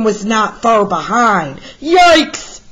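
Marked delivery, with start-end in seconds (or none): 1.8-2.6 high, loud panicked yelp; crying out in alarm. Acted. Museum director discovers the stolen painting, crying out in alarm. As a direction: shriek that the painting is gone. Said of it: "cried the museum director".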